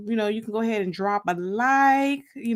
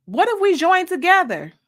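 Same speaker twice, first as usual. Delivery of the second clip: higher and louder, eager suggestion; hopeful pitch; 7 semitones higher, 5 dB louder than usual. Acted. Shantae urging her friend to enlist with her, eager and raised. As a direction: sell the idea brightly.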